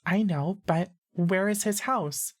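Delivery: put-on high, slow girlish voice; high-pitched girl. A narrator's reading. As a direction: draw it out in a high girl's pitch. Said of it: "and high-pitched".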